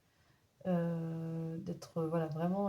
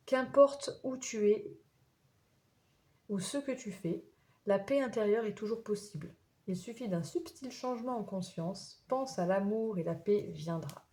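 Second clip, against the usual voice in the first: inspirational reading; 2 semitones higher than usual. Acted. Reads the card's spiritual message.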